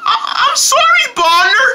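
ordinary delivery

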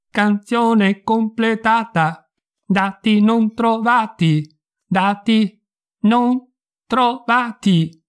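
slow, high, emotionless machine voice; cold apathetic robot. Acted. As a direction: repeat it like a looping computer. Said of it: "la voce fredda e apatica della I A".

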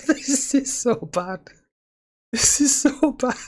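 cracking up high, words squeezed out; helpless giggle over a blunder; laughing. Real, not acted. Player laughing at his own terrible move. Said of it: "but I am laughing 'cause that was so awful".

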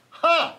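high, sudden scornful "ha"; one sharp exclamation of triumph. A narrator's reading. Kangaroo threatens Horton's dust speck, scornful ha.

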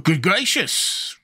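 slow, high-pitched amazed exclamation; surprised. A narrator's reading. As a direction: draw it out in surprise. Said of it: "returned the surprised guardian".

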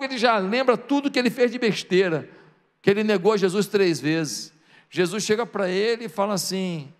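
ordinary delivery

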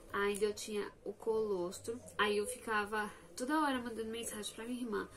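ordinary delivery

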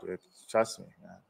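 usual speaking voice

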